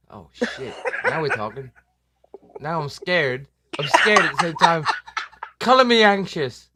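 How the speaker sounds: ordinary talk, nothing marked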